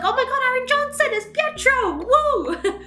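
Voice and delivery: high, squealing fangirl excitement; excited squeal. Retold or imitated. Fan recognizing the actor in the movie, squealing with delight. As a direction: squeal it out like spotting a favorite actor.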